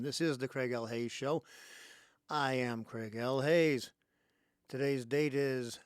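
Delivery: punchy, measured host greeting with big pauses; show-opening announcer. Real, not acted. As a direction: open the show loud and deliberate. Opens his radio show.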